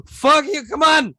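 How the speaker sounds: put-on loud, high drunken yell at the bouncer; retold drunken shout. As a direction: bellow it like a drunk lad being thrown out.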